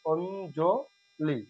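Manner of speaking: অঞ্জলি drawn out slowly and softly as "ond-jo-li"; correct pronunciation. Shown as a demonstration. Teacher gives the correct form to students, slow and soft. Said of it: "সঠিক উচ্চারণ".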